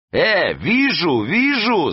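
high-pitched, drawn-out yell. A narrator's reading. Гасым spots the enemy boat, yelling. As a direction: cry it out high and stretched. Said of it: "завопил он".

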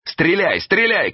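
high, urgent shouted command; shouting. A narrator's reading. Эраст Петрович orders his companion to fire, shouting. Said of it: "крикнул Эраст Петрович".

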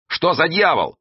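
high, startled exclamation; startled cry. A narrator's reading. Эраст Петрович sees the sea catch fire, crying out. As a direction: cry out in high-pitched astonishment. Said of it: "вскрикнул Эраст Петрович".

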